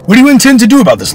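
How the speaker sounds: high, loud and fast; fast, loud urgent challenge; urgent demand. Acted. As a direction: press the lord hard for an answer. Father confronts lord.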